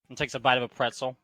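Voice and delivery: ordinary talk, nothing marked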